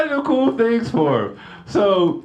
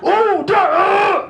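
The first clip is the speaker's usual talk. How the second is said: startled ooh; 12 semitones higher, 3 dB louder, much slower than usual. Retold or imitated. Startled reaction to daughter.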